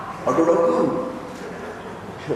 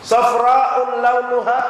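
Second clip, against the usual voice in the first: Quran recitation; 4 semitones higher, much slower than usual. Acted. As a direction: recite it slowly like scripture. Repeating the Quran verse.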